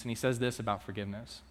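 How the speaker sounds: normal talking voice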